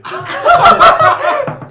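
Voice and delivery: high shrieking laugh. Real, not acted.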